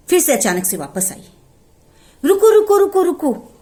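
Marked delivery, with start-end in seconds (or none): 2.2-3.5 high, loud urgent calling; mother goat hurries back shouting stop. Acted. (ऊँची आवाज़ में रुको पुकारती माँ)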